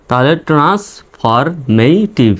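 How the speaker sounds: Transformative drawn out very slowly, each syllable clear; slowly enunciated transformative; slow demo. Shown as a demonstration. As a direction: spell transformative out slowly for learners. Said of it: "উচ্চারণ হবে".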